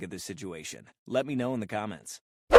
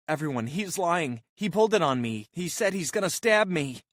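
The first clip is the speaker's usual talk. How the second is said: raised, higher accusing voice; insistent accusing cry; 7 semitones higher, 5 dB louder than usual. Retold or imitated. Karen accuses the man before the crowd, raised insistent voice. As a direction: plead with the crowd, pointing him out.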